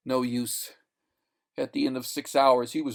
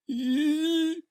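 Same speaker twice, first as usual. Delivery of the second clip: long high singing wail, fading; high eerie wail. Acted. Otherworldly voices singing, high wail. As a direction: let the sound hang like wind. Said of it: "sang a diminishing roar".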